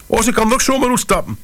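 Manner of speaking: high frantic woman's voice; panicked. A narrator's reading. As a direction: fret high and fast. Wife still panicking. (hoog en paniekerig)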